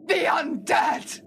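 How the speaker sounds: dramatic. Acted. Dramatic accusation of the monsters.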